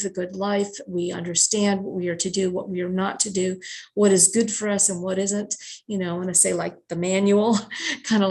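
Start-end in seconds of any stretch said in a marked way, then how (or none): none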